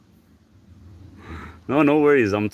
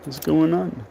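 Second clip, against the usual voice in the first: voicing the dog; 8 semitones higher than usual. Voicing the dog's thoughts.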